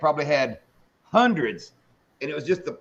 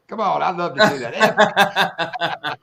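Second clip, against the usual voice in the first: laughing tease; 4 dB louder, much slower than usual. Real, not acted. Teasing friend to say F U.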